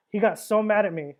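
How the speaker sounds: ordinary talk, nothing marked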